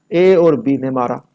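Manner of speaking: ordinary delivery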